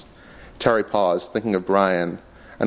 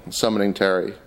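ordinary delivery, nothing marked

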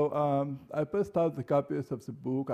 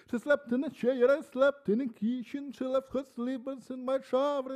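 lifted, chanting poem recital; poetry reading; 9 semitones higher than usual. Acted. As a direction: read the love poem with intensity. Reads poem [name].

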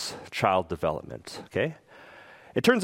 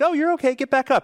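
high, louder sing-out reassurance; reassuring parent; 13 semitones higher, 4 dB louder, faster than usual. Acted. Parent to a fallen child, acted upbeat reassurance. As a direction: brush off the tumble in an upbeat high voice. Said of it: "but if they're like".

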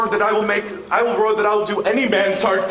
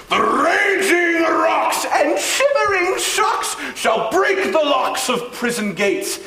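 booming, high, slow ercles rant; lofty ranting tyrant; 6 semitones higher, slower than usual. Acted. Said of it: "this was lofty".

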